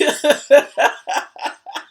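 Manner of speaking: long helpless laugh. Real, not acted. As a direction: crack up at your own joke. Cracking up at her story.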